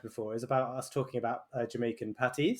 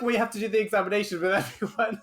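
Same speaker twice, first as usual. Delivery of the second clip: laughing through the words; giggling helplessly while telling it; words bubbling with laughter; 8 semitones higher than usual. Real, not acted.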